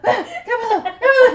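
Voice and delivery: high, loud mock panic; hammed-up startled mom; panicked waking. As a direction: wake up yelling in alarm. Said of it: "they're in the middle of battle".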